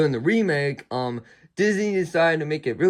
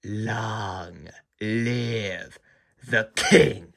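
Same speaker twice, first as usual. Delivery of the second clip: menacing whisper-line; 5 semitones lower, much slower than usual. Retold or imitated. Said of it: "Mufasa just says".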